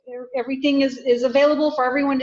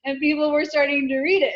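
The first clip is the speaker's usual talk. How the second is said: ordinary talk, nothing marked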